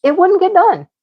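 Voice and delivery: fed-up retold protest, rising in pitch; exasperated protest. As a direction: insist it just won't get done. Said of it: "I'm like".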